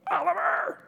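high-pitched loud call to a runaway dog; re-enacted yell. Owner calling his dog across the street, high yell. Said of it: "I mean, I'm yelling".

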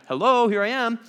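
high, bright showy hello; lightly hammed-up announcement; mock grand reveal. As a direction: play the big reveal. Imagined Jesus revealing himself.

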